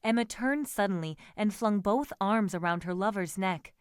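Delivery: ordinary delivery, nothing marked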